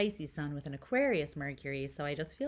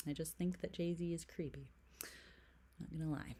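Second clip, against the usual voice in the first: whisper; 8 dB quieter than usual. Real, not acted. Confessing opinion in whisper.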